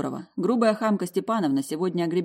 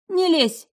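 high, loud order; sharp command; 12 semitones higher, 6 dB louder than usual. A narrator's reading. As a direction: stop him sharply. Karina grabs his jacket.

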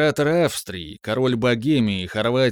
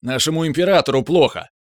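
higher, louder alarmed cry; man down shout; 5 semitones higher, 4 dB louder than usual. Acted. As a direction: call out in alarm. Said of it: "На эти вопли прибежал лейб-медик".

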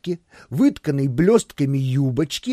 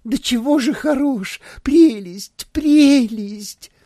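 high, loud gushing nymph voice; swooning; 10 semitones higher, 5 dB louder, slower than usual. A narrator's reading. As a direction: gush with rapture. Nymph swoons over Filodor.

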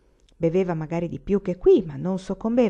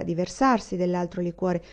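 plain reading voice

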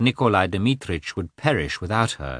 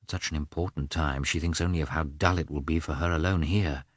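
low, soft private musing; subdued, vexed inner voice; inward thought; 3 semitones lower, 7 dB quieter than usual. A narrator's reading. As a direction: drop the voice as if thinking to yourself. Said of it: "he thought".

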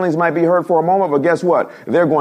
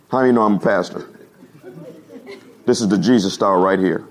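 usual speaking voice

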